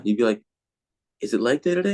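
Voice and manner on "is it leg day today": mocking coach. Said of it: "used to make fun of me".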